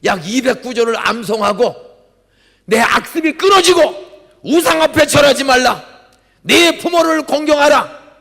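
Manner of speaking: loud, high fervent sermon voice; preaching at full force. Acted. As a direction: proclaim it like a revival preacher. Preacher reciting commandments loudly. (힘찬 설교 목소리)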